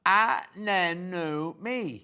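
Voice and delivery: Anemone drawn out very slowly with an open ah start; slow correcting Anemone; corrected anemone. Shown as a demonstration. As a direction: say Anemone the teacher's way, no first her. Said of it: "without the first her".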